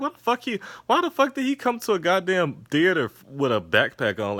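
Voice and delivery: high, disbelieving outburst breaking into a laugh; high incredulous rant about the backpack. Retold or imitated. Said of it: "I'm like".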